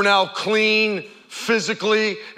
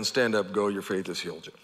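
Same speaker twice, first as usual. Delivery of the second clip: soft, low and gentle; tender blessing; 10 semitones lower, 9 dB quieter than usual. Retold or imitated. Jesus to the healed Samaritan, gentle blessing. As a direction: reassure him quietly.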